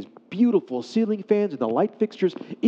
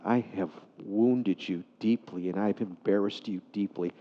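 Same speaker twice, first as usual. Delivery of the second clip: soft, low, remorseful; hushed admission of hurt, understated; retold tearful apology; 6 semitones lower, 5 dB quieter than usual. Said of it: "he began to cry".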